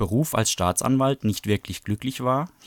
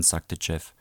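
ordinary delivery, nothing marked

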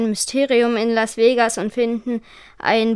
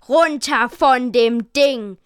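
slow, forceful order; mild read-aloud bellow, each word drawn out; yelled command; 3 dB louder, slower than usual. A narrator's reading. Frank orders the boys off the helicopter, slow yelled command. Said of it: "brüllte Frank".

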